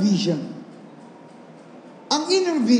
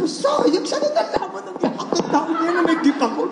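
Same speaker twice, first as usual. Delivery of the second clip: mock boastful; 5 semitones higher than usual. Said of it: "sabi ko".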